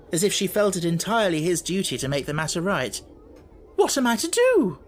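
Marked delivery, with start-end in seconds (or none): 3.8-4.8 high, loud indignant headmistress; indignant demand. Acted. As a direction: demand it, outraged and shrill. Minchin repeats her demand.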